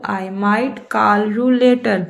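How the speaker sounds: slow, word-by-word example sentence; slow dictation. Shown as a demonstration. Teacher reading an example to Hindi-speaking students, slow and deliberate. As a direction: read it out slowly for learners to copy. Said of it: "teacher reads English example".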